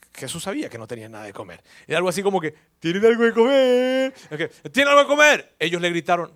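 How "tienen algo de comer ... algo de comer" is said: Jesus calling to the boat, loud. Retold or imitated.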